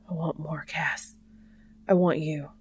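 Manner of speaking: breathy whispered pleading; gasping. A narrator's reading. Dean pleading with Cass, gasping whisper. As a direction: gasp the words out. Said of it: "he gasps".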